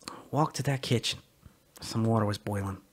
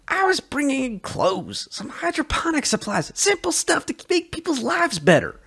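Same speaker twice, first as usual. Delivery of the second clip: much higher, louder pleading; defensive protest; 5 dB louder than usual. Acted. Sully insists to Joe his smuggling was harmless, high and pleading. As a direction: defend yourself in a rush of protest.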